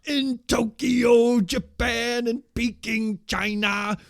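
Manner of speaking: booming high shout with long drawn-out words; hammed-up screaming cult-leader promo; screaming Master voice. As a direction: wail it out as loud as you can, stretching the syllables. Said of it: "he's doing this voice".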